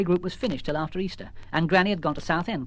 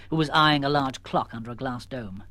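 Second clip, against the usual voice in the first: plain reading voice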